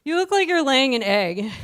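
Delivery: loud, high teasing shout; mock yell at friend. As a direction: yell it at her like a mocking friend. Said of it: "I was like".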